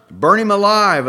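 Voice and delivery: high, loud shout. A narrator's reading. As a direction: scream for his blood. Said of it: "voices shouted".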